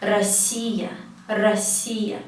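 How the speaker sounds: "Россия" as ra-SSI-ya; unstressed A first in "Россия", weight on "си"; O pronounced as A. Shown as a demonstration. Teacher repeating the rule, "Россия" said ra-SSI-ya. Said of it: "we pronounce it as A".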